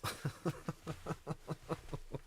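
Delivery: airy breathless laugh. Real, not acted. Laughing at own imitation.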